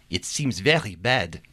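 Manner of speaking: put-on worried line in a French accent. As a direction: speak as a French widow, in a French accent. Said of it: "two French accents".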